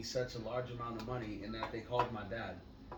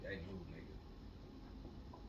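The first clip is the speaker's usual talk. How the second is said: mock stranger; 6 semitones lower, 14 dB quieter, much slower than usual. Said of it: "Ain't nobody gonna tell you".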